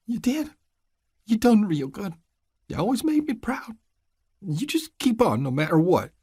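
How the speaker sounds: high, hushed, worn mountain woman; grieving old mother. Acted. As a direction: murmur like a broken old mother.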